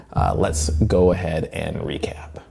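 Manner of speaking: hushed aside, whispered. Real, not acted. Narrator leaning in to viewers: whispered aside. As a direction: drop to a secret whisper.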